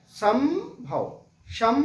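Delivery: संभव drawn out slowly with a humming म before भव; संभव slowly, lips shut on the म; slow anusvara demo. Shown as a demonstration. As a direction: hold the nasal म in संभव with the lips closed. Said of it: "मुंह से आवाज उस वक्त नहीं निकल रही है".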